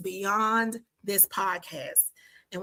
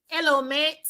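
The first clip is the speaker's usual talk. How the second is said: badly faked Australian accent, pitched up; horrible Australian accent; 7 semitones higher, 3 dB louder than usual. Podcaster greeting her Australian listeners, in a bad Australian accent. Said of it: "That's horrible accent".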